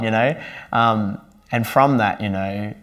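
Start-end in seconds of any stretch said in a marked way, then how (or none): none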